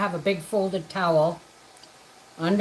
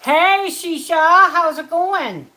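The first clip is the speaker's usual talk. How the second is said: much higher, louder, slow stretched hello; excited greeting; 7 semitones higher, 6 dB louder, slower than usual. Real, not acted. Host greeting viewer Shisha in chat, loud stretched call. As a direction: sing out the name happily.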